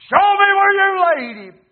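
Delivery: high, very loud shouted command; full-force roar as Jesus; called out. Acted. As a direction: boil over into a bellow. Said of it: "And he called out".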